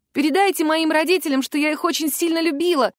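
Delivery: high-pitched farewell cry, not a full shout; desperate cry. A narrator's reading. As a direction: call it high, hoping to be heard. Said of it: "крикнула я, надеясь, что мастер Вайс услышит". (отчаянный прощальный крик, без надрыва)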